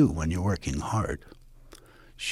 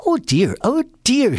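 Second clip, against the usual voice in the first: higher, louder fretting oh dear; high dismayed rabbit; 10 semitones higher, 4 dB louder than usual. Acted. Rabbit dismayed that his scissors broke. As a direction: fret in a rising high voice.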